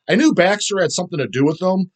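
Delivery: usual speaking voice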